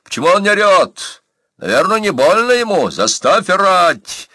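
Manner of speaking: high, loud commanding yell; angry shout. A narrator's reading. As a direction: shout it across a crowd at the executioner. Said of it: "и крикнул, обращаясь к палачу".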